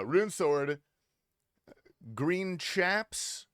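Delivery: normal talking voice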